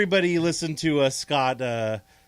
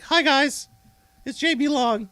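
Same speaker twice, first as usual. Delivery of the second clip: mock J B Long; 9 semitones higher than usual. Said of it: "I'll take something from Chris, like".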